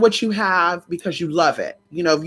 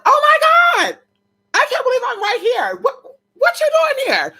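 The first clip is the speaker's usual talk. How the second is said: shocked squeal. Retold or imitated. Spotting her cousin unexpectedly, a shocked high squeal. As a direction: shriek it like you can't believe your eyes.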